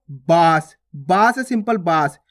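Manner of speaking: बांस read as बास, short and oral; plain unnasalised vowel. Shown as a demonstration. Teacher showing the unmarked word, oral बास for बांस. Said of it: "simple बांस".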